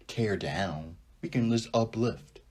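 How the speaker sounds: usual speaking voice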